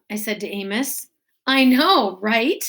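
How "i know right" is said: laughing. A narrator's reading. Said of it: "laughed Amos".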